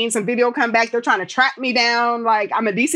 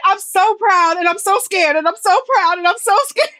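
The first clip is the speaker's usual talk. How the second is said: high, louder quote of dad, laughing through it; imitating dad; 10 semitones higher, 4 dB louder than usual. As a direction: play dad proud and scared, breaking into a laugh. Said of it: "He was like".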